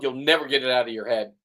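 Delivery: normal talking voice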